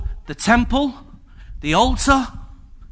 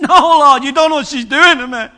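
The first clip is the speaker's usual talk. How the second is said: higher, louder whiny protest through laughter; laughing protest; 6 semitones higher, 10 dB louder than usual. Pretend. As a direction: mock-desperate complaint to the Lord.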